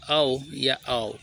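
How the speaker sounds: "औ या आओ" as open vowels, no f; correct pronunciation. Shown as a demonstration. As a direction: draw out "औ या आओ" slowly without a final f. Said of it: "शुद्ध उच्चारण क्या होता है".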